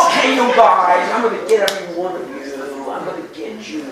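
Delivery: loud, fast gangster snarl, hammed-up; Jimmy Cagney. A priest doing Cagney for the students, gangster voice. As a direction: do a tough old-movie gangster threat. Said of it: "In the old movies".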